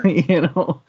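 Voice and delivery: breaks into high laughter; laughing. Real, not acted. Sheepish reader laughing at how little she has to pick on. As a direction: laughs at her own nitpicking, voice jumping up.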